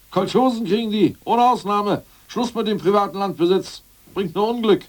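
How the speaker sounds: loud and high, hammering each demand; a zealot barking out the new order; fierce decree. Acted. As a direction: lay down the law, no compromise.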